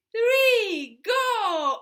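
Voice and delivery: high, loud "three, go"; loud count-in. Real, not acted. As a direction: call out the start. Count-in to chorus.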